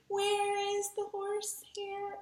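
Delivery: high, drawn-out, quiet mock-scared wail. Teacher playing scared for her young students, mock-frightened. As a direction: play scared, slow and very high. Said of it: "you get to be scared when you say".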